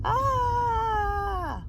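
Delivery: long, high held shriek; drawn-out squeal; high-pitched scream. Real, not acted. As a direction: let out a sustained high "ah". Sudden cry aimed at someone outside.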